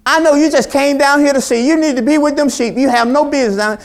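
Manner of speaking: loud, high scolding; angry big brother. Retold or imitated. Eldest brother rebuking David, loud angry scolding. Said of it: "He got anger".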